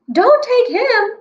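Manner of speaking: high, slow pleading cry; Pinocchio crying out. Acted. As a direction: beg like a wooden boy in alarm. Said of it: "cries Pinocchio".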